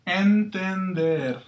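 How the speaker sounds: Entender drawn out very slowly; teacher models Entender slowly; slow model word. Shown as a demonstration. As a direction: stretch Entender for learners. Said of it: "To understand".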